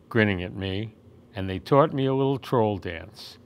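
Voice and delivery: usual speaking voice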